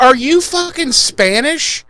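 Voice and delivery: high, drawn-out disbelieving question; incredulous. Retold or imitated. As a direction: stretch it out in mock disbelief. Acting incredulous captive in retold scene.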